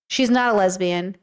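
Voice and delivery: ordinary delivery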